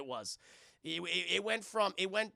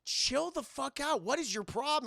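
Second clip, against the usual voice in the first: angry scolding quote; 7 semitones higher than usual. Retold or imitated. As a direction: tell him to calm down, irritated. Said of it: "she's like".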